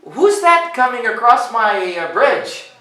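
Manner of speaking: high, loud, cheery call; cheerful ogre. A narrator's reading. Ogre to the goat on his bridge, cheerful. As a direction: call it out brightly with a little hesitation. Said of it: "the ogre asked cheerfully".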